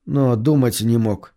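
plain reading voice

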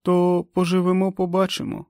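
високий жіночий голос персонажа; відповідай засмучено, значно вище; засмучена відповідь. A narrator's reading. Заплакана Френ відповідає лікареві. (засмучено, високим голосом)

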